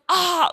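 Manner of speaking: high, loud comic cry of pain; loud pained yell. Retold or imitated. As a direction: yell like you just got hit below the belt. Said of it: "Like, he's so loud".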